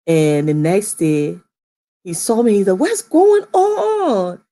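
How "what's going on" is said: high, slow, stunned question; dad seeing the cut hair, stunned; shocked. Retold or imitated. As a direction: stretch it out in disbelief.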